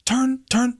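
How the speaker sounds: high, urgent imitation; retold urgency. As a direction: imitate a frantic warning in a high voice. She urges him about live mic.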